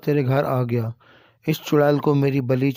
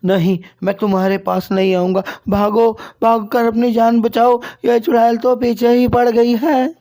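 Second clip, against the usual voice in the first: much higher, louder panicked yelling; terrified shout; 9 semitones higher, 6 dB louder than usual. Acted. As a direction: scream while running for your life. Fleeing the witch in terror.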